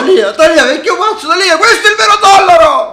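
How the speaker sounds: shouting through laughter; laughing shout. Real, not acted. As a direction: yell while cracking up. Laughing shouts mid chaos.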